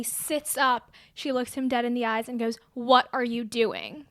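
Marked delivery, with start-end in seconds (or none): 2.7-4.1 very slow, each word separate and clear; deliberate, pointed confrontation; coherent and sober. Retold or imitated. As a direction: imitate someone suddenly dead sober. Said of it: "In a very coherent, sober way".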